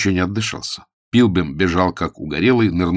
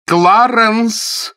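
high loud call of a name; shout; 7 dB louder than usual. A narrator's reading. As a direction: yell for him impatiently. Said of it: "крикнула леди Констанс".

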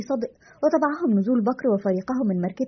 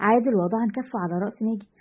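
ordinary talk

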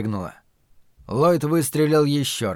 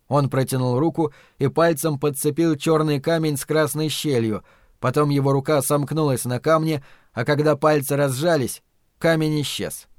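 plain reading voice

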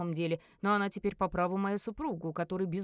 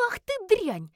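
high, loud furious screech; яростный крик; 11 semitones higher, 7 dB louder, slower than usual. A narrator's reading. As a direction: explode at a rival. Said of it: "заорала Элис". (яростно орёт, высоко и громко)

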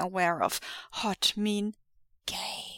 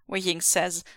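ordinary delivery, nothing marked

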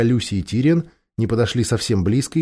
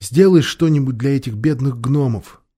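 understated tearful plea; through tears. A narrator's reading. Girl begging the lion for the poor dwarfs, tearful. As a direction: plead with a touch of tears. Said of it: "сказала Люси сквозь слёзы".

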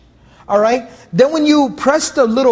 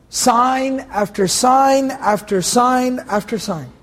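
each "sign" drawn out slowly; teacher slowing down so every "sign" lands; slow emphatic listing; much slower than usual. Acted. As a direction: lay out "Sign after sign" one slow beat at a time. Stressing God's repeated signs.

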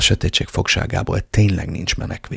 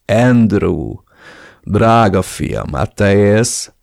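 put-on louder, slow theatrical old man's welcome; fake delight; 5 dB louder, much slower than usual. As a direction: ham up a fake joyful greeting. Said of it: "színészkedve Ramirez". (színlelt örömmel, színpadiasan)

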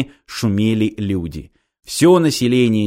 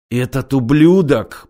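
hissed through teeth; 2 semitones higher, slower than usual. A narrator's reading. Ilya about Tyutya to the engineer, seething. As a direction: spit it through clenched teeth. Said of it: "процедил Илья".

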